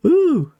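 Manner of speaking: high-pitched "ooh" after a sneeze; high mock sneeze follow-up; imitating mum's sneeze. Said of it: "then she would go".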